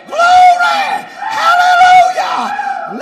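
very high, drawn-out holler; ecstatic praise shout. Real, not acted. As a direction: scream praise to heaven at full voice.

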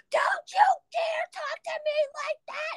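high, sharp furious yell; furious shout. Retold or imitated. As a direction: snap back in a rage. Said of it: "So I fucking flipped".

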